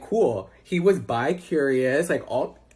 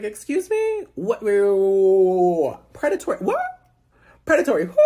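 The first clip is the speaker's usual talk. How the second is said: high squealed double take; hammed-up shock, voice leaping up; incredulous; 4 semitones higher, 4 dB louder than usual. Retold shock at predatory accusation.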